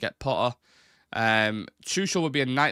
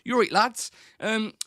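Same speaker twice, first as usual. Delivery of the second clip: higher, hammed-up Bristolian coach voice; mock Bristolian accent; 9 semitones higher than usual. Pretending Potter gives a team talk, in a hammed-up Bristolian accent. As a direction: play a West Country manager barking orders in broad Bristolian. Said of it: "Let's pretend it's Bristolian".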